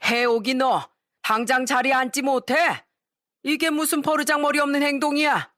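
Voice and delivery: hammed-up angry father yelling; father-in-law shouting. A narrator's reading. Father-in-law ordering daughter to sit back down, mild mock yell. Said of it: "큰 소리를 내더군요".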